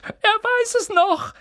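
very high, loud, drawn-out squeal; squeaky, overjoyed little man; excited cry. A narrator's reading. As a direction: cry out in sheer delight. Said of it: "rief Daedalus Dickel".

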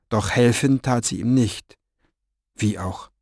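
ordinary delivery, nothing marked

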